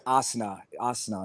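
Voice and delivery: Asna for Arsenal, R and L dropped; imitated African accent. Mimicking comments from online fans, African-accented Asna. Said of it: "they're pronouncing it Asna".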